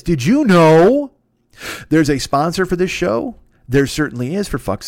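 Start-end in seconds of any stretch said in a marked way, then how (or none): 0.0-3.4 loud, drawn-out teaser question; mock announcer hype. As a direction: stretch it out big and loud.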